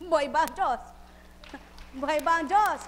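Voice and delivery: loud preacher's call to the crowd; rallying shout. Real, not acted. Preacher shouting to congregation, loud rallying call.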